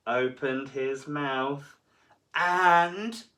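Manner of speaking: slow, rising suspense build. A narrator's reading. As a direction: draw it out before the bite.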